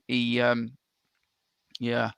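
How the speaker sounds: ordinary delivery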